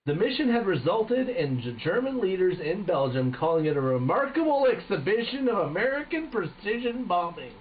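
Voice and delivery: high-pitched, hammy American general; exaggerated American accent. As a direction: proclaim it in a put-on American voice. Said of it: "It's a fucking American".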